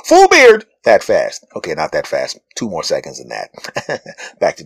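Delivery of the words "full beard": much higher and louder exclaim; excited reveal. Shown as a demonstration. Comedian revealing his instant beard, loud high exclaim. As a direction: burst out with the punchline. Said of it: "how fast my beard grows".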